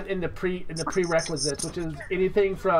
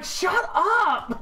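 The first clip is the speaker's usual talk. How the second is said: high-pitched mock protest; imitated embarrassed wife; 11 semitones higher than usual. Embarrassed wife to husband in a restaurant, imitated protest. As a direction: mimic a wife shushing her husband.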